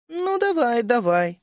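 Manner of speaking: high, drawn-out start sliding down; feigned indifference. A narrator's reading. Тереза answering the narrator's threat to shoot, feigned indifference. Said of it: "сделанным равнодушием отозвалась Тереза".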